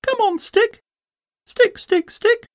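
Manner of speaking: very high-pitched, excited Roo cheering; little Roo calling out. A narrator's reading. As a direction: urge it on in a tiny squeaky voice. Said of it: "calling out".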